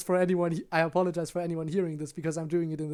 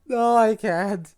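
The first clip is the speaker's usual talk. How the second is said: laughing; 3 semitones higher, 6 dB louder, slower than usual. Real, not acted. Helpless laughter after embarrassing take.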